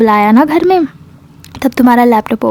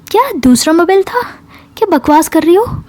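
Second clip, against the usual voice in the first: high-pitched disbelieving question; incredulous challenge; 6 semitones higher than usual. Retold or imitated. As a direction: pounce on the excuse in disbelief. Friend disbelieves the new excuse. (अविश्वास से, ऊँचे सुर में सवाल)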